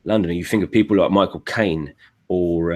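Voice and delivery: common London accent, not quite Cockney; Londoner accent. Shown as a demonstration. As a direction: talk like a working Londoner. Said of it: "like Londoner".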